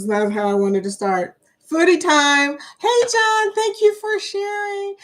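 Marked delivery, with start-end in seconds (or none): 1.7-2.6 bright upbeat announcement, louder; excited host calling foodie time. Real, not acted. Livestream host announcing segment. 2.8-5.0 much higher, gushing hello; high excited thanks to a viewer; excited greeting. Real, not acted.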